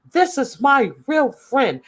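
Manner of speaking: high mocking impression of a bickering woman; hammed-up high-pitched mimicry; mocking quote. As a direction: squeak it out, mocking her. Repeated mock line.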